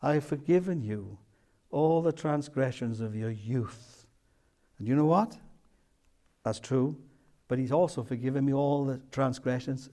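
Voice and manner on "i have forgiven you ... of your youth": tender. Retold or imitated. Preacher quoting the Lord's tender words to his soul, softly. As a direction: say it gently, like a quiet word to the soul. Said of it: "he said that tenderly to my soul".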